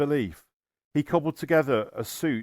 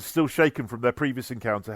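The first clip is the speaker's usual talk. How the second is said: usual speaking voice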